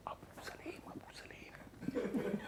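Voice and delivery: urgent hushed whisper. Retold or imitated. Husband whispering to his wife through the door. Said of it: "दरवाजा खोला धीरे से".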